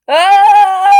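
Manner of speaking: long, high, loud scream; drawn-out shriek of shock; horrified scream. Acted. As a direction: scream out in horror. Reacts in horror to snapped neck.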